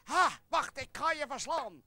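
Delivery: loud, high knightly challenge; hammed-up heroic attack shout; battle cry. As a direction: charge in shouting at the dragon. Knight charges at the dragon.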